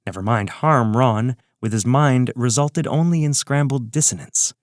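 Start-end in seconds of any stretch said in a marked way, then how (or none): none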